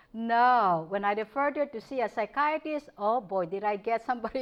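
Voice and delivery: normal talking voice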